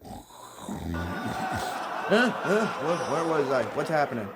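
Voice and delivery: playing startled out of a doze; groggy start, then a jumpy, confused huh; startled awake. Host jolts awake after the senator's speech, mock startled. Feigns waking from a boring speech.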